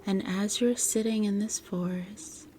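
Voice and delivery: measured, soothing guide with long pauses; guided meditation. Acted. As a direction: gently lead the listener into relaxation, phrase by phrase.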